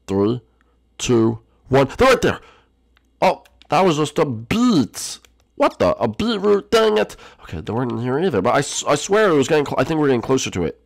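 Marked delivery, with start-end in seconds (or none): 2.0-5.2 sudden high, loud shout; ambush yell. Pretend. Bursts in on intruders after countdown. 5.5-7.2 high-pitched, exasperated disbelief; baffled outburst. Pretend. Finds only a beetroot.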